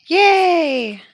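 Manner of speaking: high, loud cheer; a joyful high yay. Acted. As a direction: cheer with delight. Cheering the plan.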